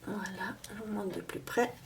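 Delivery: whispered aside while leaning close to the camera. Real, not acted. Showing the box close up to viewers, whispering. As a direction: breathe the words, no voice at all.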